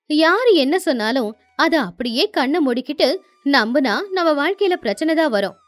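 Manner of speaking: ordinary delivery, nothing marked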